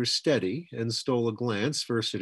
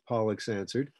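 ordinary delivery

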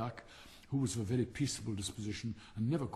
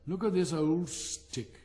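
put-on excited boy; 5 semitones higher, 4 dB louder, much slower than usual. Boy excited about stick.

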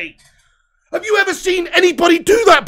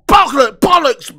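angry loud curse; 2 semitones lower, 9 dB louder, slower than usual. Real, not acted. Fan swearing at the goal.

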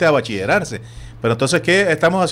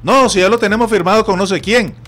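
raised, louder mock yell of a pushy official; retold shout; 6 semitones higher, 5 dB louder than usual. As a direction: throw the line across the field like a heckler, higher and louder. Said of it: "me gritan".

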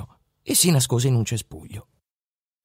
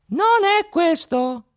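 tiny high voice, slow and deliberate on "Non è questo"; a tiny boy correcting the robbers from inside a horse; slower than usual. A narrator's reading. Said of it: "rispose una vocina". (vocina acuta che dice "Non è questo")

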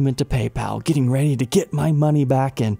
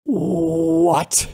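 drawn-out, higher "What?" of disbelief; shocked disbelief; 6 semitones higher than usual. Acted. Reacting to PayPal ruling against him, shocked disbelief. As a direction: stretch one stunned "what", pitched up. Reacts to email denying his case.

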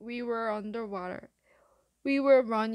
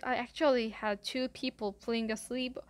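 ordinary delivery

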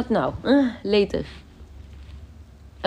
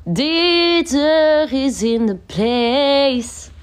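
hype announcer; 5 semitones higher, 4 dB louder, much slower than usual. Pretend. Announcing a viewer's arrival.

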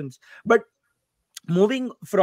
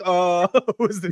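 drawn-out mock "uh", breaking into a laugh; imitating puzzled hesitation; slower than usual. Host teasing both guests about their long pause, laughing mock hesitation. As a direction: mimic their slow, lost reaction while laughing. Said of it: "Both of you were like".

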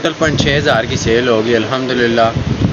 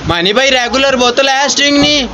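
calling out; 8 semitones higher than usual. Real, not acted. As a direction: shout to someone far off. Calling out to distant Mani.

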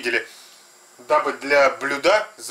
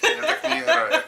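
laughing. Real, not acted. Protests partner grabbing meat, laughing.

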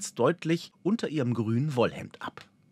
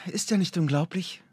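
raised pitch, sighing with bliss; blissful sigh; 7 semitones higher than usual. A narrator's reading. Elf woman marvelling at her home forest, blissful sigh. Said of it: "seufzte die Elbin selig".

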